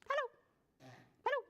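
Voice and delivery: very quick, short hello; as fast as possible. Shown as a demonstration. Leader greets preschoolers as fast as she can, super quick. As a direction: rattle off the greeting in an instant. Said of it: "as fast as we can".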